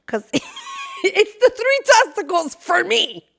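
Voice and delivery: high, slow, laughing through it. Real, not acted. Cracking up over the phrase's meaning, laughing.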